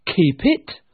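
high, loud echo; incredulous nephew. Acted. Nephew repeating his uncle's words, incredulous. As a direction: throw it back as a question. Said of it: "repeated Scrooge's nephew".

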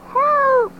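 high, loud cry for help; shouting. A narrator's reading. Girl yelling to the planes, high and loud. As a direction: yell up at the sky. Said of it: "I shouted".

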